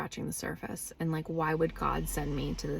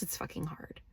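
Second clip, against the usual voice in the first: hushed whisper; 11 dB quieter than usual. Real, not acted. Vlogger confiding to viewers, whispered. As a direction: let it drop to a breathy whisper. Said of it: "whispered aside".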